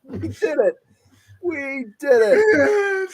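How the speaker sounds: high, slow crying shout of triumph; overjoyed cry of victory, hammed up; mock crying cheer. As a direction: wail it out like a weepy wrestling champ. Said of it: "Kurt Angle crying after every match".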